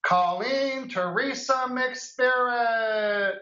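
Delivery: high, drawn-out announcer call; game show host. Pretend. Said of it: "With my game show voice?".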